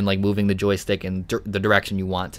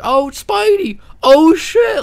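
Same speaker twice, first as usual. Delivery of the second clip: excited bystander; 7 dB louder, much slower than usual. Acted. Bystanders reacting to Spider-Man.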